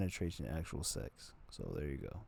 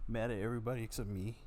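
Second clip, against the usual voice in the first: ordinary talk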